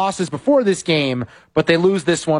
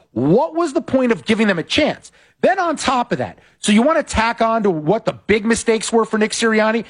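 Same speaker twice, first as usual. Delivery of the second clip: normal talking voice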